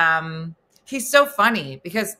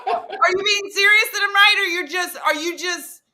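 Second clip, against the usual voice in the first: laughing disbelief; 10 semitones higher, 5 dB louder than usual. Retold or imitated. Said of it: "I'm like".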